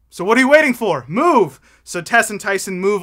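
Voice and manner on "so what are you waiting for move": loud, higher barked order to get going; bossy shout. Acted. Boss ordering Tess and Tyson to move, loud shout. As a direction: shout them into motion.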